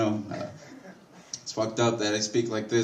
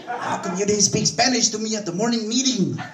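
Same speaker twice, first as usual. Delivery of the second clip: puzzled coworker; 9 semitones higher than usual. Retold or imitated.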